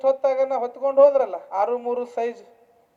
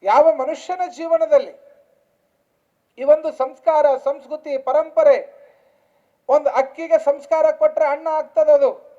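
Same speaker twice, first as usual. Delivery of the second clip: raised, measured sermon delivery with long pauses; preaching; 5 semitones higher, 8 dB louder than usual. Real, not acted. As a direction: proclaim each phrase to the crowd. Preacher at full force. (ಗಟ್ಟಿ ದನಿಯ ಪ್ರವಚನ)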